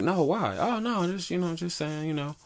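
high-pitched back-and-forth quote; high, lightly mocking dialogue voice; imitated online exchange. Acting out exchange with Facebook poster.

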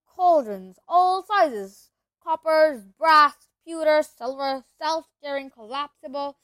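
excited listing. A narrator's reading. Narrator listing cauldrons to listeners, excited. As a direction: rattle off the wonders in awe.

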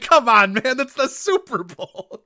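high, loud mock outrage, slow on the end; imitating the announcer. Friend imitating the Vikings radio announcer in exasperation. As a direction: squeal it like a baffled radio man. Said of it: "I remember the announcer being like".

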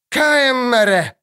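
high, long-drawn cry; calling out. A narrator's reading. Dryad cries after the fleeing girl, calling out. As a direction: stretch the foreign word in a high call.

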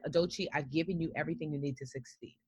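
soft voice of God answering her; calm inner voice. Retold or imitated. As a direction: speak as a quiet inner voice of reassurance. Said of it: "this voice that said".

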